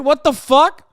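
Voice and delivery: ordinary talk, nothing marked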